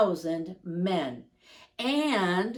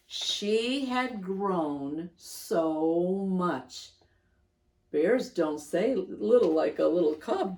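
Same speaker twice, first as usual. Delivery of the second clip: ordinary delivery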